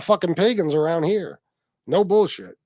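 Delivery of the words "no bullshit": ordinary talk, nothing marked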